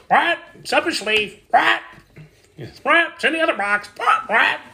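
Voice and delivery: high, loud squawky parrot voice; shrill squawks between short heckles; hollering parrot. Acted. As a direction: holler it out like a tattletale bird. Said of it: "was always hollering out how his tricks".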